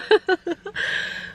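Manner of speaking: a high burst of laughter at her own mistake; giggling at her own goof; laughing at herself. Real, not acted. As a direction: crack up at your own blunder.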